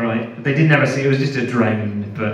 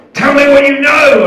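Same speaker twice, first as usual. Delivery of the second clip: much higher, much louder demand; shouted demand; 12 semitones higher, 12 dB louder than usual. Retold or imitated. Interrogator shouting at the prisoner for answers. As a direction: yell it at the prisoner. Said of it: "and then he said".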